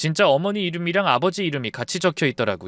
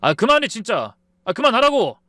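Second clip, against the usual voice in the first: high, exasperated outburst building louder; angry; 8 semitones higher, 4 dB louder than usual. A narrator's reading. Son telling mother to stop, angry and exasperated. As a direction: cut mother off angrily. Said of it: "아들이 화를 내면서".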